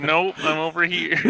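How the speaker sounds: playful call from across the room; mock yell. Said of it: "I'll yell from across the room".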